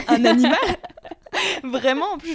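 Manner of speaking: laughing out loud mid-sentence. Real, not acted. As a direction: burst out laughing while talking. Laughing at her own confession.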